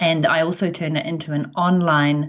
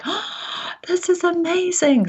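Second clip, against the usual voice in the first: excited; 13 semitones higher, slower than usual. Retold or imitated. Recalled excited reaction to Forbes.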